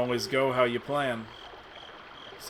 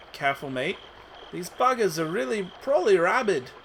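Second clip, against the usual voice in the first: hammed-up British accent, pitched higher; exaggerated British accent, higher and louder; bad British accent; 7 semitones higher, 3 dB louder than usual. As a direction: put on a cheeky British accent for a warning. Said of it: "She's British, now, I decided".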